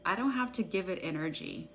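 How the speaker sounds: a guided EFT tapping script read for followers; guided tapping meditation. Acted. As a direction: call each tapping point, then the affirmation, at an even unhurried pace. Leads a guided tapping session.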